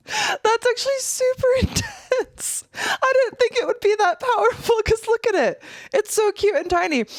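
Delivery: high, breathless amazement; real excited. Real, not acted. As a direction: still reeling, voice up high. Still reeling from the toy.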